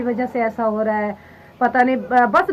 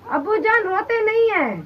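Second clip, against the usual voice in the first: high tearful pleading; 11 semitones higher, 4 dB louder than usual. Real, not acted. Daughter begging father to stop crying.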